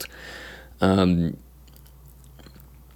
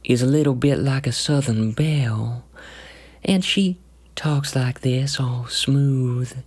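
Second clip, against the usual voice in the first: southern belle; 5 semitones higher than usual. Shown as a demonstration. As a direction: purr it like a smooth-talking southern belle. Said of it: "the voice that I have taken for the rapier".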